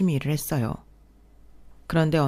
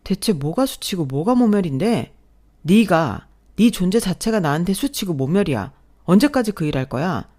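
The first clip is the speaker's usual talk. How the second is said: louder, raised voice, hurling insults; furious shout; 2 semitones higher, 3 dB louder than usual. Acted. Husband yelling that his wife shames him, furious shout. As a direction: spit out each word with contempt.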